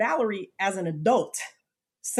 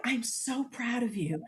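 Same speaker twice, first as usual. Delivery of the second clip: tender; 4 semitones higher, 7 dB quieter than usual. Retold or imitated. Teacher tells her she's proud.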